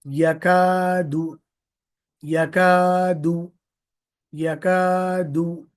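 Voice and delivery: यकादु slowly with the long आ held out; stretched long vowel. Shown as a demonstration. Teacher drilling the last word of the line. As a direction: hold the आ in यकादु. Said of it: "उसको हम खींचना है".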